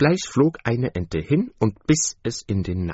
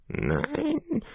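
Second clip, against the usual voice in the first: a sudden high cry from the mother duck; mother duck calling; 6 dB quieter than usual. A narrator's reading. Mother duck cries out to her ducklings, high. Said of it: "rief die Mutter".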